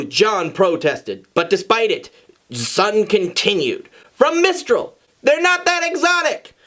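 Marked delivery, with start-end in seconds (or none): 4.1-6.5 much higher, squeaky objection; high-pitched objection. Acted. Friend objecting to the exotic claim, squeaky high.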